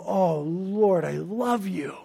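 soft worshipful love; tender adoration. Retold or imitated. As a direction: murmur it lovingly. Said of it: "in adoration of".

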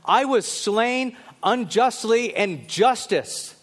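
slow, high, forceful cry for justice. Acted. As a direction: cry out as the wronged victim, drawing the words out. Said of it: "What Abel's blood was crying out was this".

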